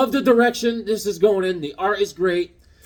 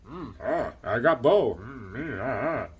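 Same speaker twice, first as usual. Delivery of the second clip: grunting warrior; 7 semitones lower, 5 dB quieter than usual. Pretend. Voicing his drawn warrior.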